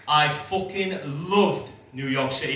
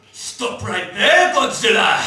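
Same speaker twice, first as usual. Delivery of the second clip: loud, slow, higher heroic shout; brave man's shout; 6 semitones higher, 8 dB louder, slower than usual. Retold or imitated. Brave little man confronting Godzilla, shouting slow and loud. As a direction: yell it like a tiny soldier facing a monster. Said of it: "one little brave man going".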